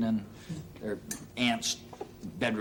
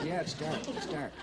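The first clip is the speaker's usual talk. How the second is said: hushed; 4 semitones higher, 6 dB quieter than usual. Retold or imitated. The man hiding in the closet answers the kid, hushed. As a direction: keep it down, someone might hear.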